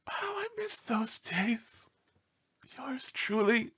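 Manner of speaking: slow, sighing whisper; a dog pining for old days, whispering; wistful whisper. Acted. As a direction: breathe it out longingly. Homesick dog signs off letter.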